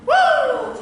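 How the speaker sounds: very high, loud whoop; rabbit's hooray whoop. Acted. Performer hoorays as the rabbit, high loud whoop. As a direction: let out a soaring cheer. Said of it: "and hoorays".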